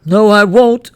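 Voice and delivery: put-on high, loud, breaking boy's voice; boy sobbing over his dead dogs; grieving boy. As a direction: cry it out to mama in protest. Boy protests.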